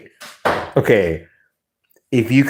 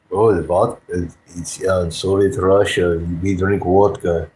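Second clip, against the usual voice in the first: mock Russian accent; 2 semitones lower than usual. Shown as a demonstration. Showing chat an inspired impression, mock Russian accent. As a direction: play it as a hammed-up Russian.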